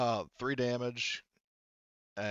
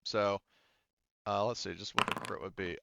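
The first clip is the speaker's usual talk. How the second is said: normal talking voice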